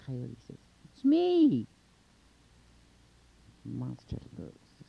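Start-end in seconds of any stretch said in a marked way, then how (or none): 0.9-1.7 very high, loud, drawn-out answer; high sing-out call. A narrator's reading. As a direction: holler it down to someone far below. Coyote calls down to the monster.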